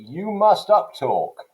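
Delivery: high, loud, drawn-out mock decree; mock-authoritative command, pitched well up; government issuing orders. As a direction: proclaim it slowly like an official order. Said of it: "that's not the government saying".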